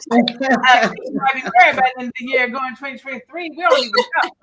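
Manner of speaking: high, loud, fast gleeful tease; excited teasing. Real, not acted. Teasing co-host Keisha about getting married, giddy and loud. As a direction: tease her, giddy and quick.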